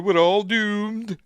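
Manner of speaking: slow, high, loud wailing catchphrase; John Laurie catchphrase. Retold or imitated. Impression of the Dad's Army actor, slow loud wail. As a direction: drag out the doom like a gloomy Scot. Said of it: "he used to be going around saying".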